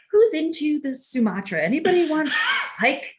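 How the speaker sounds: imitating her son, bright and high, breezily offering coffee; chirpy host voice. Son ignoring a tense kitchen moment, chirpy mock host voice. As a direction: play the cheerful barista to dodge the fight.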